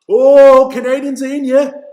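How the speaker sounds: sudden loud, high burst of excitement; real excitement at a scoring chance; excited outburst. Real, not acted. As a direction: jump up as the play turns dangerous. Sudden chance in front of net.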